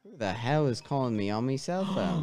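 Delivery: low, grumbling mock Aussie; Australian accent. Guest interrupted by a phone during accent dare, mock Australian. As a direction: complain in a put-on Australian accent. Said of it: "I'm going Australian".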